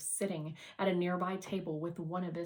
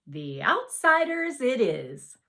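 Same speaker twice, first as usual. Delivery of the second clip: slow, bright, much louder and higher; cheerful; 6 semitones higher, 12 dB louder than usual. Acted. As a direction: announce it happily, decision made. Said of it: "cheerfully taking the case".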